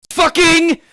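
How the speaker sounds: sudden loud high blurt; frustrated outburst. Real, not acted. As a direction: let it burst out. Exploding in frustration.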